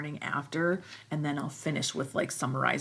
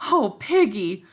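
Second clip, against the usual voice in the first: low and stricken; 5 semitones higher, 11 dB louder than usual. Acted. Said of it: "Ralph's voice, low and stricken".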